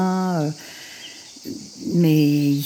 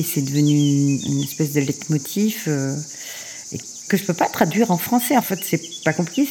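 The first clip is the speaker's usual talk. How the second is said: ordinary talk, nothing marked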